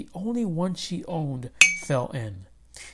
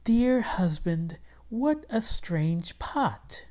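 higher, slow wife voice; wondering old wife, drawn out; wife's voice; 5 semitones higher, slower than usual. Acted. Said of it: "His wife greeted him".

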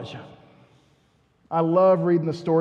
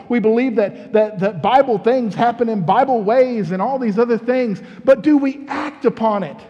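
rising preacher's challenge, louder; preaching; 4 semitones higher, 4 dB louder than usual. Real, not acted. Pastor challenging the church on prayer, preaching. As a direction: press the church hard with each question.

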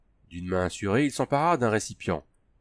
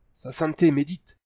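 nervous; 7 semitones higher, 3 dB louder than usual. A narrator's reading. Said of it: "déclara nerveusement Kazmar".

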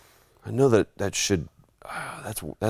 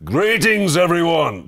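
loud, high, grand greeting; hailing the crowd as Conan; 9 semitones higher, 5 dB louder, slower than usual. Retold or imitated. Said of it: "I'm up there going".

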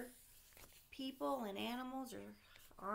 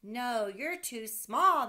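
louder, slower refusal; fireman crying out; 3 semitones higher, 13 dB louder, slower than usual. Acted. Fireman crying out to Sam, loud slow refusal. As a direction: call the no down to the boy. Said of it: "the fireman cried".

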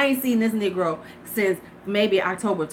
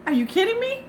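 incredulous; 12 semitones higher, 3 dB quieter than usual. Retold or imitated. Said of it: "I was like".

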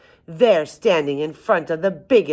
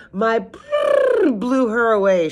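high, loud, slow elephant boast; shouted boast; 8 semitones higher, 5 dB louder, slower than usual. A narrator's reading. Elephant boasts to lion and bear, shouting. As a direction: shout it high, stretching out the blast. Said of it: "shouted the elephant".

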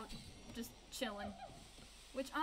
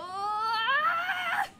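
sudden loud startled cry, much higher; startled shout; 11 semitones higher, 13 dB louder than usual. Real, not acted. Gibbon player crashing mid-swing, startled loud cry.

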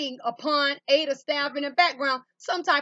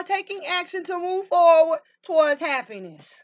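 ordinary talk, nothing marked

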